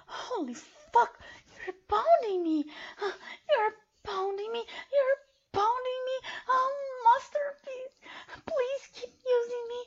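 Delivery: breathless cries. Acted. Sub crying out to her master, high and strained. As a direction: gasp each phrase out under strain.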